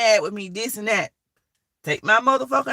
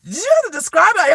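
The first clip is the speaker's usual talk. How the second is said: mock exasperated question; 9 semitones higher, 4 dB louder than usual. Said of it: "I'm like".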